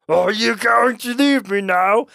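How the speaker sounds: high, loud, slow put-on question; pleading miner character. A narrator's reading. Eddie asks the ghost to leave, high drawn-out character voice. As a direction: draw out the question in a hammed-up high voice. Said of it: "Eddie asked".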